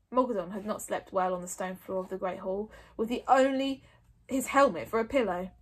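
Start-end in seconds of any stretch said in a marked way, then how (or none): none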